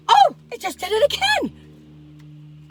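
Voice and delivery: high, slow startled cry; alarmed as it happens again; real alarm, voice jumping up; spooked. Real, not acted. Locks flip again, spooked.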